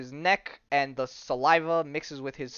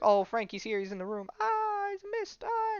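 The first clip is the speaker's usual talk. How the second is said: high-pitched hammed-up cries of alarm; mock panic. Woman warning that he's in the room, mock panicked wail. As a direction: play a frightened woman, voice shooting way up. Acting woman attacked by mist.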